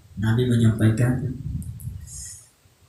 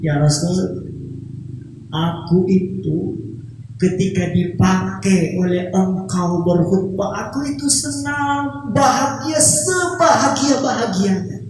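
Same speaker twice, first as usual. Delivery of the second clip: higher, tender, sorrowful voice of the pulpit; weeping old pulpit; 5 semitones higher than usual. Acted. Old pulpit confessing its sadness to the Prophet, higher and tearful. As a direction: plead like a sad little thing wanting to be used. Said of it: "Kata mimbar itu".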